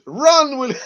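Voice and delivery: catchphrase yell. Acted. His catchphrase yelled at player.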